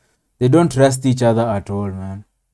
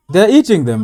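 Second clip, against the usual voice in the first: "eating" hit harder and louder; louder repeat; 7 semitones higher, 7 dB louder than usual. Real, not acted. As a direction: say it again, much louder, in disbelief.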